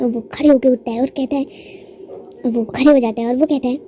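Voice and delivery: ordinary delivery, nothing marked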